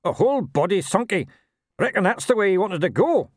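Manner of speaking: high, sly little gnome; cheeky gnome with a grin. A narrator's reading. Gnome voiced in story.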